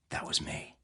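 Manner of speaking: hushed, breathy admission, barely voiced; whispered confession. Agent quietly admits to the arms dealer he did it, whispered. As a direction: drop to a secret whisper. Agent confides his secret op.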